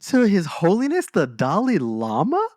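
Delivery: high, loud, slow outraged disbelief; loud stretched-out scandalized question; mock shock. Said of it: "I was like".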